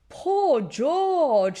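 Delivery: lightly voiced sympathetic father; a hint of a kind Daddy Pig. Father comforting his upset son, lightly voiced pity.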